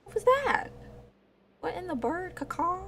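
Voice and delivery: high, incredulous what-was-that; surprised. Real, not acted. Streamer reacting to his own voice crack, high and baffled. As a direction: squeak up in disbelief.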